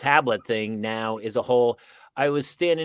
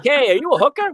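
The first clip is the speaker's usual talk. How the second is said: put-on much higher, louder kid voice; 12 semitones higher, 5 dB louder, faster than usual. Playing a kid asking the teacher, high and loud. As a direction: pipe it up like a curious kid. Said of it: "And the kid's like".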